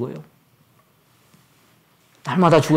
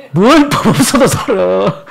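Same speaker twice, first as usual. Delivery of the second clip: scoffing; 5 semitones higher, 5 dB louder, slower than usual. Real, not acted. Scoffing at praise.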